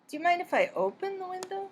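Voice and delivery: "open" lifted high as a second request; stress on open. Shown as a demonstration. Teacher demo of asking a second time, emphasis on "open". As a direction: sound impatient by hitting "open". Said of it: "changed the emphasis to open".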